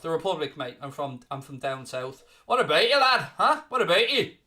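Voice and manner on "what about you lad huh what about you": put-on higher, louder Northern Irish accent on "What about you". To a viewer from the North, in a Northern Irish accent. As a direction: ask it like a lad from Belfast. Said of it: "That's my Northern Irish impression".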